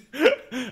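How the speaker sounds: big high burst of laughter; loud laugh. Real, not acted. Laughing at the story.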